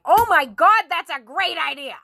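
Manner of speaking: mock-gleeful outburst; retold amused delight. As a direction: exclaim it as if tickled by the idea. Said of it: "I started fucking laughing".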